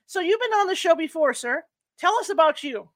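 speaking up for a caller. Real, not acted. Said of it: "I'll try to talk a little louder for you".

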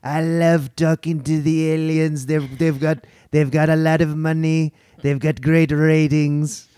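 slow, drawn-out Trump voice; slow Trump-style boasting; Trump impression. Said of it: "Now do Trump talking about aliens".

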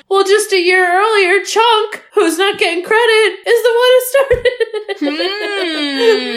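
rising, high, laughing protest; indignant rant. Real, not acted. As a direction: build up a mock outraged defense.

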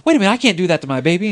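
high, loud, fast mock panic; panicked mother. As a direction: blurt it out like a frantic mom. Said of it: "Then she was like".

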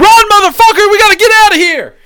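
high, full-volume mock-panic scream; all-out hammed-up shriek of alarm; panicked scream. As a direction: scream it like you're fleeing for your life. Sudden mock panic interrupting the sign-off.

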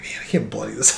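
soft, laughing under the breath. Real, not acted. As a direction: chuckle through the line. Laughing retold thought.

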